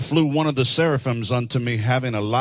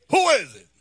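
higher comic squeak, a bit louder; Mister Robinson skit voice; 10 semitones higher, 4 dB louder than usual. Retold or imitated. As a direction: call out like the skit neighbor. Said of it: "Remember Mister Robinson's Neighborhood?".

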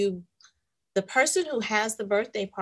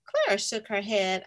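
usual speaking voice

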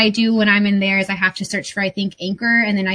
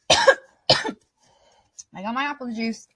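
ordinary talk, nothing marked

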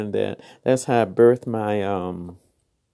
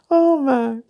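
laughing; 14 semitones higher than usual. Real, not acted. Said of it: "Did I say that right?".

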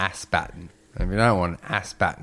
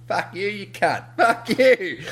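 high-pitched mock yelling at pursuers; laughing, high taunt; retold taunting yell; 14 semitones higher, 4 dB louder, slower than usual. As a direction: hurl gleeful abuse from a safe spot. Acted taunting of chasers.

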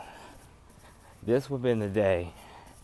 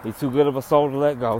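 commanding shout; 4 semitones higher, 7 dB louder, faster than usual. Retold or imitated. Voicing God's command.

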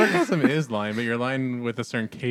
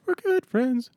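very high, squeaky-pitched echo; playful echo; 4 dB louder, slower than usual. Retold or imitated. Joining in the joke about good friends, playful echo. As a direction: push it way up high, in jest.

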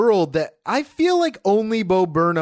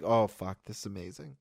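awed hush; 11 semitones lower, 12 dB quieter, faster than usual. Retold or imitated. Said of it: "And I was like".

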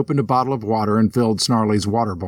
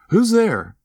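startled call; 11 semitones higher than usual. Acted. Startled, calling into the dark.

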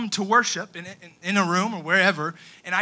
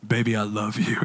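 mock seductive; 9 semitones lower than usual. Shown as a demonstration. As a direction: ham up a crooning husband. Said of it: "No, I don't say it like that".

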